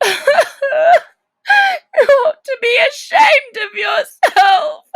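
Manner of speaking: high, loud, tearful scolding; Alice crying out at herself. Acted. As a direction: shout at herself through tears. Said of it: "said Alice".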